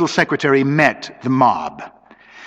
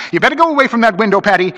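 urgent warning; 9 semitones higher, 3 dB louder, much faster than usual. Acted. Husband urgently warns wife.

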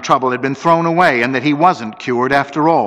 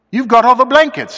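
high, agitated complaint; complaining; 13 semitones higher, 6 dB louder, faster than usual. A narrator's reading. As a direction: pitch it high and accuse. Frightened friend complains in the dark.

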